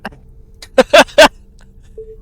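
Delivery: high squealing burst of laughter; loud high laugh. Real, not acted. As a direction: let out a shrieking laugh. Big laugh.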